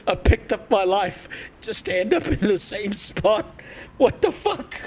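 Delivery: high-pitched, incredulous, on the edge of laughter; laughing mock outrage. Emigrant who moved for nothing, laughing mock outrage.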